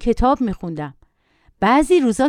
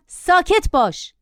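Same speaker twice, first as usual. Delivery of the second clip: slow, firm ساکت باش; commanding; 8 semitones higher, slower than usual. A narrator's reading. Narrator firmly orders the boy, slow command. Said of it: "با تحکم گفتم".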